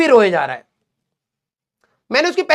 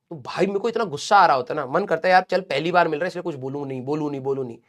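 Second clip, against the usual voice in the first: fast, softer self-talk, holding back anger; muttered restraint; 6 semitones lower, 6 dB quieter, faster than usual. Retold or imitated. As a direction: mutter it to yourself, fading out on the last words.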